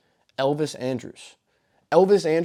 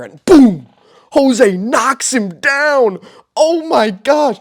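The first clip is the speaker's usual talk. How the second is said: loud, high, drawn-out shout of disbelief; stunned excited yell; 8 semitones higher, 9 dB louder, much slower than usual. Real, not acted. Host reacting to the knockout replay, yelling in disbelief. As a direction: bellow it like the knockout just happened.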